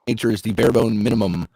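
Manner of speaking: ordinary delivery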